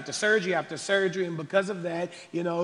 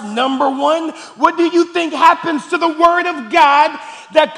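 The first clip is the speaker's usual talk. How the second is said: high, loud pulpit exhortation; fiery, insistent preacher's cry; full-force preaching; 10 semitones higher, 13 dB louder than usual. Real, not acted. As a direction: shout the challenge at the congregation.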